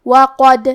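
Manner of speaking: loud demo of وقد with bouncing د; qalqala on dal. Shown as a demonstration. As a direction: say وقد, make the د echo. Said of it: "تو ہم یہ حرف کو ہلاتے ہوئے یہ کلمہ کو پڑھیں گے".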